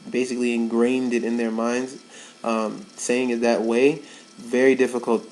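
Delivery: ordinary talk, nothing marked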